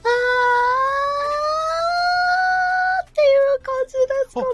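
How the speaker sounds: high and loud falsetto. Shown as a demonstration. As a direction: climb up into falsetto. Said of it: "一番高いところからいきましょうか".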